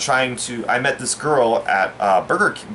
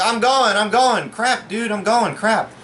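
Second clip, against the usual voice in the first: panicked self quote; 10 semitones higher, slower than usual. Retold or imitated.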